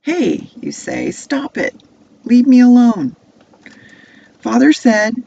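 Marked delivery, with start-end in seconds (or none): 0.0-0.5 high, loud cry of objection; protesting shout. Acted. Boy to brothers tugging his coat, protesting shout. 1.1-5.1 high, loud plea to be left alone; protesting shout. Acted. Boy fending off his brothers, protesting shout.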